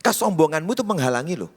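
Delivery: normal talking voice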